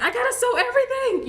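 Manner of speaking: high, loud burst of eagerness; gushing, pitch way up, retelling a rush of inspiration; inspired excitement. Retold or imitated. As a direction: exclaim it as if suddenly fired up. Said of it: "I was so inspired".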